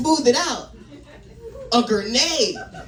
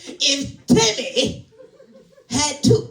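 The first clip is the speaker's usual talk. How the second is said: high, slow, bad African accent; horrible African accent; 6 semitones higher, 3 dB louder, much slower than usual. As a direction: badly fake an African accent, drawn out. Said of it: "That's a horrible African accent".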